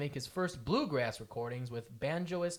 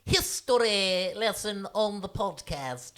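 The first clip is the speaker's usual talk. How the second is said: mock posh old English accent, slow; slow, stately old-timey English voice; 4 semitones higher, 5 dB louder, slower than usual. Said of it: "I'm going to do my old English voice".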